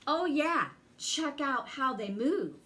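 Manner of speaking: ordinary delivery, nothing marked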